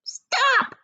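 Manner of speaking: sharp, high cry of stop; sudden stop. Acted. Aunt Spiker halting James, a sharp high cry. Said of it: "Aunt Spiker said quickly".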